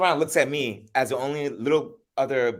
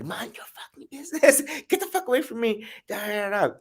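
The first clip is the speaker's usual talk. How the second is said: imitating an angry mother, pitched way up; pissed-off mom; 13 semitones higher than usual. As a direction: snap back at the nosy stranger. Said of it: "my mom instantly got pissed".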